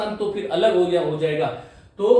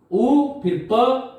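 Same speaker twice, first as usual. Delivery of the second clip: slow spelled out; 5 semitones higher, much slower than usual. Shown as a demonstration.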